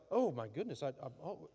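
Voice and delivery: a touch of mock fluster, trailing off; lightly voiced panic. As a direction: play a flustered God. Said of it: "God's gonna be all like".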